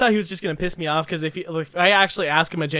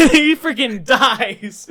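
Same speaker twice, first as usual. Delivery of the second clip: laughing; 4 semitones higher, 5 dB louder, much slower than usual. Real, not acted. Laughing over the joke.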